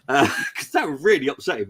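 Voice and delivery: words broken by laughter; laughing. Real, not acted. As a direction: giggle through the line. Laughing about upsetting Cole.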